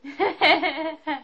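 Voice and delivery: high burst of laughter; giggling loudly; real laugh. Real, not acted. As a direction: laugh out loud at yourself. Laughs after outburst.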